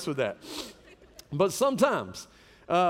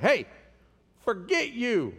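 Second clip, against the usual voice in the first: higher-pitched, dismissive retort; mock dismissal of a show-off; acted brush-off; 5 semitones higher, slower than usual. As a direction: brush them off in a raised pitch. Acted brush-off to a self-centred person.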